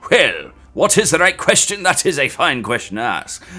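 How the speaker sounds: put-on English accent, theatrically delivered; hammed-up English gentleman's accent. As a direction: answer in a mock English accent. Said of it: "but maybe I shall this time".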